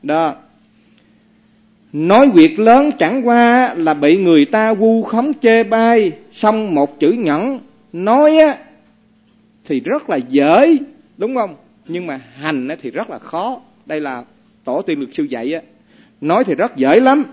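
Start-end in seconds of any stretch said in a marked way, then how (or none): 1.9-13.6 slow recited teaching, louder; reciting verse. Acted. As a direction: intone the verse with weight. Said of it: "Ông có bài kệ này". (đọc kệ chậm và nhấn mạnh)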